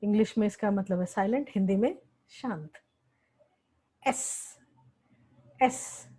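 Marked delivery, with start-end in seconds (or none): 4.1-4.6 एस as a bare voiceless hiss; एस whispered as a plain s sound. Shown as a demonstration. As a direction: breathe out एस as a soft s hiss without voice. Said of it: "इसका sound तीन तरीके से आता है".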